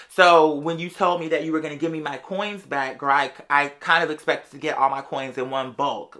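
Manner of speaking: normal talking voice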